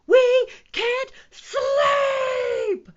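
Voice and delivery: very high, slow, drawn-out yell; exasperated stretched-out shout. A narrator's reading. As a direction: wail it at full volume. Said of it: "shouted all the animals".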